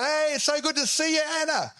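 high-pitched gushing hello; effusive greeting. Acted. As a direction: greet a friend with hammed-up delight. Acted greeting at church.

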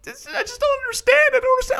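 very high, squeaky fake sob; mock crying. Girlfriend driving away from climber, mock crying. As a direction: whine it tearfully, way up high. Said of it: "she starts crying 'cause she's like".

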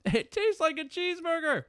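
delighted squeal of surprise; high, thrilled first-bite reaction; excited. Real, not acted.

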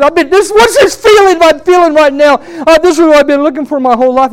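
high, loud amazed outburst; imitating a man's loud outcry; yelling. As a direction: cry out in overwhelmed wonder. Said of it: "he started yelling out as loud as he could".

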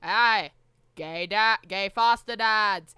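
put-on loud, slow Kennedy accent; JFK voice. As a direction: stretch it out like JFK giving a speech. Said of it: "in the J F K voice".

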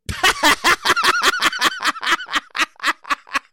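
high cackling laugh. Real, not acted. Host cracking up at his own skit. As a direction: burst out laughing.

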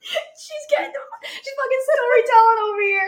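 very high squeal through laughter; helpless laughter. Real, not acted. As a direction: gasp it out while cracking up. Friend cracks up at her storytelling.

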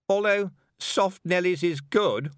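cruel little smile. A narrator's reading. Anagramma mocking Tiffany's answer with slow cruel sweetness. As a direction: sweeten the words with a sneer. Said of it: "with a cruel little smile".

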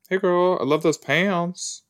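put-on high girly voice. Voicing a character.

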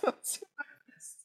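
laughing it out, nearly unvoiced; breathy laugh. Real, not acted. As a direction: giggle through the words. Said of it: "laughing, unvoiced".

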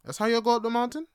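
mock a hiker in a Jamaican accent. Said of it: "'Cause they're like".

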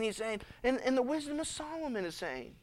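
usual speaking voice

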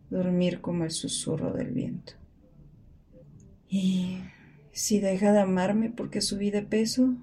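soft, near-whispered worrying; hushed inner voice. Acted. Woman worrying in bed, hushed and soft. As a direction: murmur the doubts as if half asleep.

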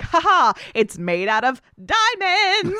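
spring the surprise with glee; gleeful reveal. Pretend. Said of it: "she's like".

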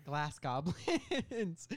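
drawn-out words breaking into laughter; laughing. Real, not acted. Pitcher returning to his silly plot, cracking up. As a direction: crack up midway through the phrase.

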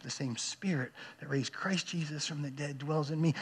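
hushed inner reassurance; quiet self-reminder. Acted. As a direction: say it low and privately to yourself. Quiet self-reminder when overwhelmed.

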